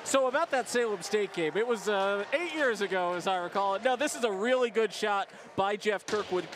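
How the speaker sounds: lively hockey color commentary; play-by-play commentary. Real, not acted. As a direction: call the replay like a broadcaster. Color commentary over the replay.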